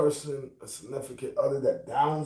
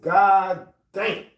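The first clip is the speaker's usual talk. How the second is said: loud, higher amazed god damn; stunned; 6 semitones higher, 6 dB louder than usual. Retold or imitated. Man reacting to a stranger's compliment, loud and amazed. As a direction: blurt it out, floored.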